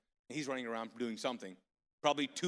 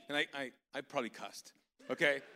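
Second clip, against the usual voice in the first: ordinary delivery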